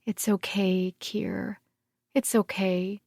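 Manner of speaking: soft, soothing reassurance. A narrator's reading. Gemma soothes the sobbing monk, soft and tender.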